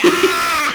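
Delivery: high loud yelp; short yell. Shown as a demonstration. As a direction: let out a quick yell. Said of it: "it's just gonna be me yelling".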